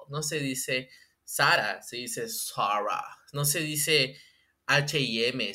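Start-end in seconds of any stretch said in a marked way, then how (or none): 1.8-3.0 slow, snooty English "Sarah"; mock English accent. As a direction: correct "Sarah" with mock sophistication. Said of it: "cómo se pronuncian bien las marcas".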